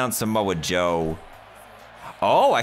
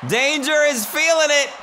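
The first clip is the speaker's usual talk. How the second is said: hype commentator; 12 semitones higher than usual. Pretend. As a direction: call the match like a ringside announcer. Mock wrestling commentary.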